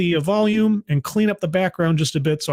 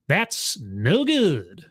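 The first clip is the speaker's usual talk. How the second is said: very slow, drawn-out catchphrase; Sonic; much slower than usual. Retold or imitated. Streamer quoting a cartoon catchphrase, drawn out. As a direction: stretch each word out in a swooping cartoon catchphrase. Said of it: "as Sonic used to say".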